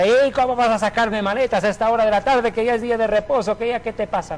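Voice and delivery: imitando un regaño a gritos, agudo; grito retado del padre. Retold or imitated. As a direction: reclama como un padre indignado, voz subida. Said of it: "le grita". (grito de reproche imitado)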